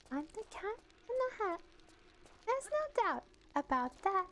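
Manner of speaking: high, soft uwu character voice; tiny uwu storybook reader; cute uwu voice. Shown as a demonstration. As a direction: put on a high, cutesy audiobook voice. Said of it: "the cute uwu voice".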